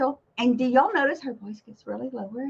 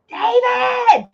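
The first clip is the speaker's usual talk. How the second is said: loud call across the house; yelling; 12 semitones higher, 8 dB louder than usual. Retold or imitated. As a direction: call out his name from far away. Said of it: "yelling at him".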